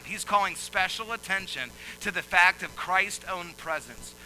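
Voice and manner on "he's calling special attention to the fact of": loud, high preaching cadence; fiery pulpit delivery; full-force preaching. Acted. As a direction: preach it out to the hall.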